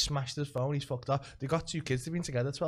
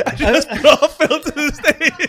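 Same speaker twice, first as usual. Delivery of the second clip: slow, high, laughing out the words; 11 semitones higher, 14 dB louder, slower than usual. Real, not acted. Comedian telling his mate the punchline, laughing hard and slow. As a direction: giggle through the punchline.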